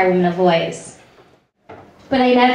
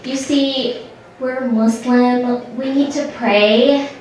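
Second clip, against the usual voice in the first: slow, careful re-explaining; patient, drawn-out correction; confused; much slower than usual. Retold or imitated. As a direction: spell it out slowly to someone not getting it. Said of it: "a little bit confused".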